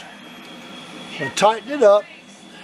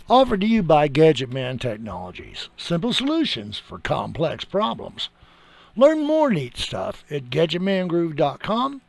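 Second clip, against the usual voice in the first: ad read; 3 semitones lower, 4 dB quieter than usual. Acted. Closing ad read.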